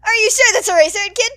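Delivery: big, loud, cheery hero voice; loud radio host. Acted. Mike cheering up the lost kid, loud. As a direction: boom out the reassurance.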